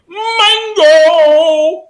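high, loud, drawn-out angry holler; really angry. Retold or imitated. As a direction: holler it long and high, mock-furious. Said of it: "Really angry".

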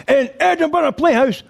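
high-pitched emcee introduction; show announcer. Retold or imitated. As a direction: announce the act in a high, ringing voice.

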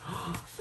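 quick, soft intake of breath; startled gasp. Real, not acted. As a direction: gasp quietly in suspense. Reacting to the last cards.

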